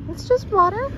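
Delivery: high pet talk. Real, not acted. Reassuring pet at water.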